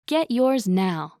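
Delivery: usual speaking voice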